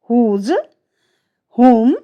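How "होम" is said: होम, w बोले बिना; होम साफ़ होम कहें; silent w. Shown as a demonstration. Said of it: "डब्ल्यू जो हमारी है वो हमेशा साइलेंट". (होम, डब्ल्यू silent)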